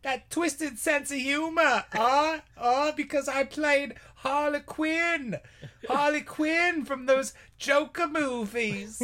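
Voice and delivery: high, slow Aussie teasing; hammy Australian actress; mock Australian accent. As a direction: tease in a high put-on Australian voice. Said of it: "And I'm Margot Robbie".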